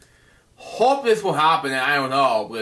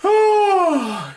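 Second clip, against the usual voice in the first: high sigh; 4 dB louder than usual. Real, not acted. Reader reacts to the dragon.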